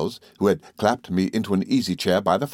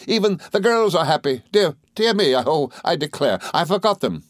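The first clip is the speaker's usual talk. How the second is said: high, happy, then flustered; fluttery, pitched-up self-reproach; excitable friend; 8 semitones higher than usual. A narrator's reading. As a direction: exclaim as if suddenly remembering.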